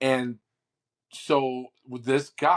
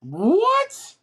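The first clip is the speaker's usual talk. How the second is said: a sudden, much higher and louder "what?"; shocked what; 7 dB louder than usual. Real, not acted. Co-host hears police officer listed, shocked high outburst. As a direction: blurt it out in real disbelief.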